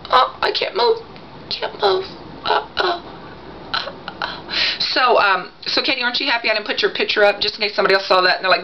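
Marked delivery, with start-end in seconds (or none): 0.1-4.5 high, strained play-wounded voice; hammed-up wounded whimpering that dies away; mock stabbing pain. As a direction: act freshly stabbed, fading into weak gasps. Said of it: "yeah, it just stabs".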